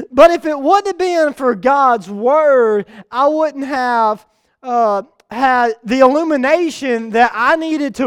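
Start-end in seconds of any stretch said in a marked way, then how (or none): none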